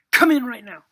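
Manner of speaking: urgent call. Acted. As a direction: call him in at once. Rebbe's urgent summons.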